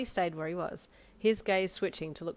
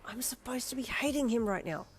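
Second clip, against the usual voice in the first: frustrated growl; 6 semitones higher than usual. Acted. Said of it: "you growled internally".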